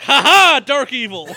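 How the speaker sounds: loud hammed-up evil villain laugh; mock villain. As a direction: ham up a cartoon villain cackling. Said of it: "something was like".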